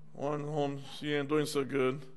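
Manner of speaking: ordinary talk, nothing marked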